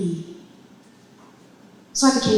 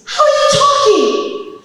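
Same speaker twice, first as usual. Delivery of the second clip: very high, loud, drawn-out disbelief; astonished shriek; 10 dB louder, slower than usual. Retold or imitated. Husband amazed his wife can talk, astonished shriek. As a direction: shriek the question in utter amazement.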